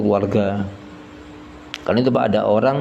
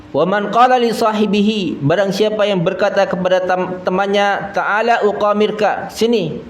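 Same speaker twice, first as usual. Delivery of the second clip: high, measured Arabic recital with translation; hadith recitation; 8 semitones higher than usual. Acted. Preacher reciting the gambling hadith to listeners, high-pitched. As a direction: keep the pitch raised like a recitation.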